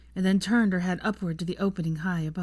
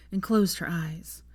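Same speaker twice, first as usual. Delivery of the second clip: plain reading voice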